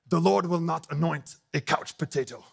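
put-on German accent, punched at the start; playful German-accent impression. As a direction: imitate a preacher with a German accent. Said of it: "in his awesome German accent".

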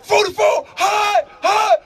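high, barked quarterback cadence; high, projected football holler; snap-count call. Retold or imitated. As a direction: call signals over the line.

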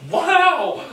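very high, loud wow; drunk wow. Retold or imitated. Drunk teen retelling the party, loud amazed wow. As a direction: cry it out in drunken awe. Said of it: "And then, like".

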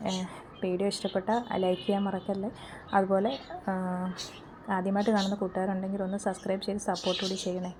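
hushed, near-whispered sign-off with a lost voice; strained, husky voice. Real, not acted. Vlogger asking viewers to subscribe, voice nearly gone, whispering. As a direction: whisper the outro, voice almost gone. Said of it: "ഒച്ച അടച്ചിരിക്കുകയാണ്".

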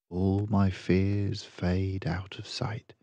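slow, soft whisper; hushed, fading calm. Acted. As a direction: let the words drift away in a hush. Fears fading away.

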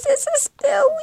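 the voice cracks up high in fear; scared. Real, not acted. Player panicking as the game malfunctions, frightened high whimper.

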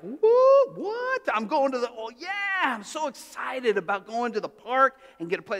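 put-on squealing high, thrilled little boy; imitating his giddy younger self, high and loud at first; excited kid. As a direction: gush like a child who just heard about the park. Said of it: "I was like".

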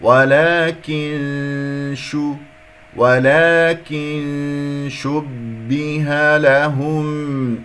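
ولكن chanted very slowly, n humming into the sh; ikhfa recitation. Shown as a demonstration. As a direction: hold a long nasal veil between ولكن and شبه. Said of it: "نون شين إخفاء".

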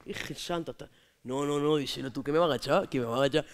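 ordinary talk

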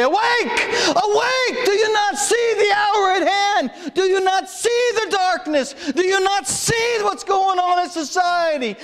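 high ringing shout of Awake; God calling out. Acted. Preacher voicing God, shouting awake to sleepy believers. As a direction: cry it out like God waking a sleeping church. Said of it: "And God would come and say".

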